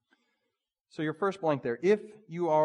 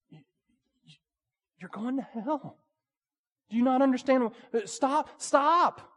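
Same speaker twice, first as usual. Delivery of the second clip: rising, pleading cry, louder toward the end; anguished plea; 9 semitones higher, 3 dB louder than usual. Acted. Jesus pleading with Pharisees to stop, anguished high plea. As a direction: beg them to stop, voice climbing high.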